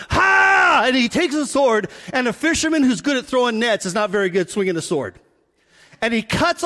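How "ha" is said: sudden high, loud war yell "Ha!"; hammed-up Braveheart shout; mock warrior battle cry. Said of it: "all Braveheart and goes".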